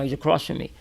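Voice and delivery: normal talking voice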